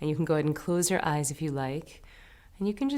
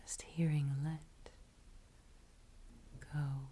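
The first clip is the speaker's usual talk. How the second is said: hushed, near-whispered mantra; ever so gentle; 11 dB quieter, slower than usual. Shown as a demonstration. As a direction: let the words fade like an echo in the mind. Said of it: "Letting it be ever so gentle".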